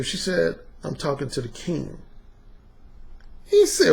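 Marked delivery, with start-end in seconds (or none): 0.8-2.0 obedient. Retold or imitated. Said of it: "She was obedient".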